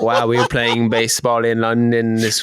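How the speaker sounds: badly faked British-Jamaican accent; accidental Jamaican accent. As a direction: keep up a failed London accent. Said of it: "Was that a Jamaican accent?".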